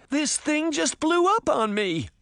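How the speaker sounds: very high, distressed complaint; high-pitched upset wail; crying out. A narrator's reading. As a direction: cry out in protest, pitch way up. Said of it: "Sabrina cried".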